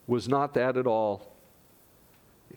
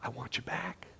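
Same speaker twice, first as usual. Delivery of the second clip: breathy, intimate whisper; 11 dB quieter than usual. Acted. Jesus to Peter, a hushed whisper. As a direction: whisper it like a tender plea.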